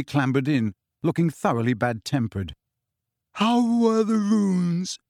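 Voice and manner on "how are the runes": yawning. A narrator's reading. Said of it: "yawning and stretching".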